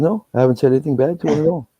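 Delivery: high, quick, put-on denial; mock-innocent protest. Groom denying trash talk to his wife, high and innocent. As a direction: voice jumps up, all feigned innocence.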